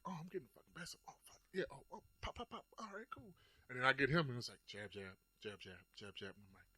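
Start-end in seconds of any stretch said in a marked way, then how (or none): 0.1-3.3 hushed inner monologue. Retold or imitated. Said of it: "I was like".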